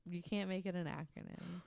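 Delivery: ordinary talk, nothing marked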